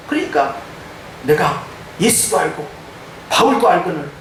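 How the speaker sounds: ordinary talk, nothing marked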